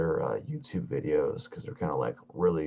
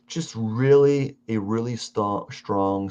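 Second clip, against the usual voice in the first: slow, drawn out; 3 semitones higher, 4 dB louder, slower than usual. Real, not acted. Dragging out, feeling doubt.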